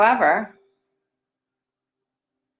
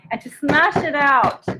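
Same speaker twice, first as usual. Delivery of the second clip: ordinary talk, nothing marked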